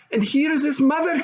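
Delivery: put-on high, eager child's voice; little princess. A narrator's reading. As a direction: show off her friend eagerly.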